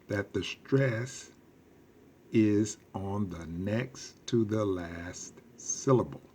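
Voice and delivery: teacher pacing each word; slow emphatic rule; slow and deliberate. Shown as a demonstration. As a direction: slowly spell out the rule for the class. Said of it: "teacher stressing the rule".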